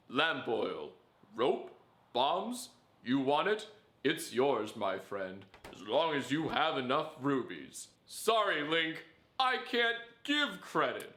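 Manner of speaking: lightly voiced merchant pitch, drawn out; shopkeeper voice. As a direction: play the greedy shopkeeper haggling with Link. Quoting shopkeeper meme to Link.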